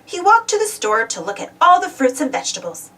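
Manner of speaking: ordinary delivery, nothing marked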